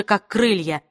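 usual speaking voice

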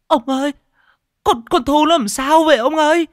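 a mother crying out in panic; panicked cry. A narrator's reading. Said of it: "bà Thanh kêu lên". (giọng kêu hoảng hốt, cao vút)

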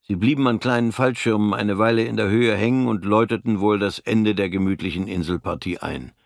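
ordinary delivery, nothing marked

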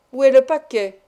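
loud, brisk question; curt demand. A narrator's reading. Showman demanding the boy's bundle, loud and curt.

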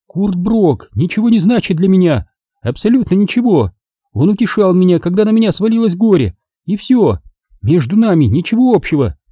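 angry widow fending off the detective's questions. A narrator's reading. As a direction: push back hard, offended. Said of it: "На сей раз Марго рассердилась".

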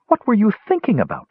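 high, fast, urgent demand. A narrator's reading. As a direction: press him hard and quick. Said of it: "she demanded".

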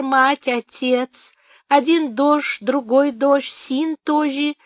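put-on high, slow, heavily lisping old woman; heavy lisp. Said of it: "сильно шепелявя".

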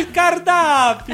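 hammed-up Italian for the menu; Italian accent. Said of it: "Ai, que vergonha".

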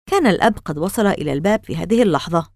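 plain reading voice

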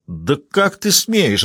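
slow, drawn-out, offended protest; every word stretched in shocked indignation; indignant outrage. A narrator's reading. Blonde indignant at the cop's insult, slowly outraged. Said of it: "возмутилась Сэнди".

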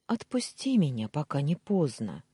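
ordinary delivery, nothing marked

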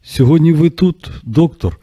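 slow, halting, high question, tripping over the words; stammering. A narrator's reading. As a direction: stumble through it, caught off guard. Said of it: "затинаючись".

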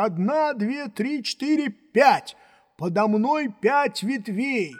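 calling out a count. A narrator's reading. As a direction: count up and call the result down. Jupiter counts aloud from tree. (громко считает с дерева)

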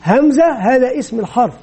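normal talking voice

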